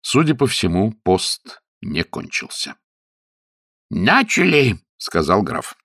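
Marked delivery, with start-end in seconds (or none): 3.9-4.8 raised-pitch command; giving the signal. A narrator's reading. Count starting the pig-calling, high command. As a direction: launch them with one call.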